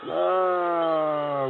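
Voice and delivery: ordinary talk, nothing marked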